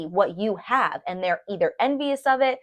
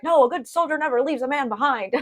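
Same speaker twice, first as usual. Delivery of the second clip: brave toy soldier; 7 semitones higher than usual. Retold or imitated. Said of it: "And he's like".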